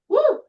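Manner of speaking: playful high-pitched woo of release; a squeal of relief in the stretch. Real, not acted. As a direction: let out a bright, high whoop. Exclaims during shoulder turn.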